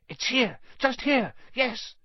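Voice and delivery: high, thrilled discovery; sudden joyful relief; excited. A narrator's reading. Harry finds the mirror room.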